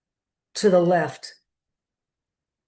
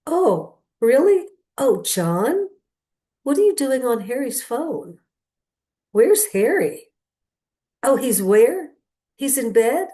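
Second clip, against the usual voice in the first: surprised on the phone; 4 semitones higher, 4 dB louder, slower than usual. Retold or imitated. Friend hearing surprising news on phone.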